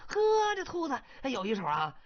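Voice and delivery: high, loud startled wolf voice; surprised wolf. Acted. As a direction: caught off guard by the clever rabbit.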